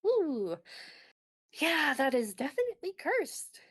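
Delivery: high, sharp intake then knowing dread; ooh, that's bad news; mild grim officer voice; wary hiss. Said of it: "sucks in a breath between her teeth".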